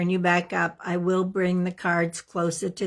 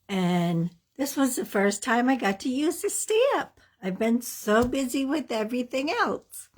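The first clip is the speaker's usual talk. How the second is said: usual speaking voice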